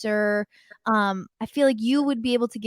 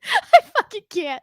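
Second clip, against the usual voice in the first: laughing; 9 semitones higher, 5 dB louder than usual. Real, not acted. Laughing at own face pulling.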